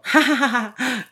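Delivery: slow, deliberate fake laugh "ja, ja, ja". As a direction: laugh it off, a little forced. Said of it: "me río, ¿no?".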